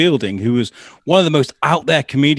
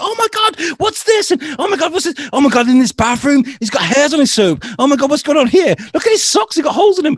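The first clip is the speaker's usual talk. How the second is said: very high, frantic mock squealing; chaotic excitement; 4 dB louder than usual. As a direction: imitate makeover guys bursting in, scandalised. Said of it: "it's just chaos everywhere".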